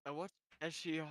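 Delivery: soft, wistful storytelling; subdued story narration. A narrator's reading. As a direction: narrate quietly, letting the hurt linger. Soft narration after sad scene.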